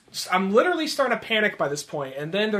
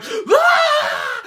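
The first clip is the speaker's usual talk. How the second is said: very high mock bawling wail; long, loud imitated crying scream; 8 dB louder than usual. Host imitates a man bawling in the street, high hammed-up wail. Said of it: "full on screaming and crying".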